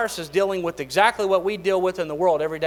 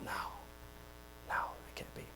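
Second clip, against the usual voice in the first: whisper; 8 semitones lower, 20 dB quieter, much faster than usual. Retold or imitated. Whispered dread of parenthood.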